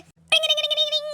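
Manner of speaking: high sing-song ding ding; phone ringtone. Retold or imitated. Imitating her phone ringing as mom calls.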